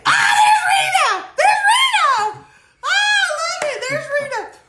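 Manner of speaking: very high, loud shriek of delight; real excited squealing. Real, not acted. Host spotting the email writer arriving, squealing with delight. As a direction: squeal and shout through laughter. Host spots Rita joining live.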